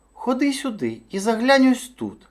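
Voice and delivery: high-pitched little hare; high, inviting storybook hare; coaxing hare. A narrator's reading. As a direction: lure the bear over in a high voice. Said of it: "мовив заєць".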